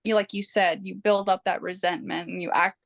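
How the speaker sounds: normal talking voice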